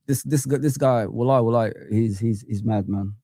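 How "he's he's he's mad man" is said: ordinary talk, nothing marked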